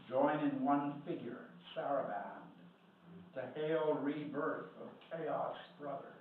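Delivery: measured poem recital with deliberate pauses; poetry reading. Acted. Poet reading a poem to an audience, measured recital. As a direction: recite line by line, stretching key phrases. Reads third poem aloud.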